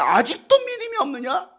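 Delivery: high, incredulous reproach; rebuke. Acted. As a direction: rebuke with the voice pitched right up. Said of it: "책망하지 않을까요". (높은 목소리로 책망하듯)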